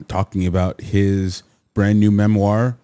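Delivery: normal talking voice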